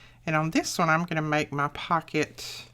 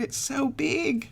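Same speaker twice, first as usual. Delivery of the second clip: awed granddaughter; 9 semitones higher, slower than usual. Retold or imitated. Granddaughter's message retold.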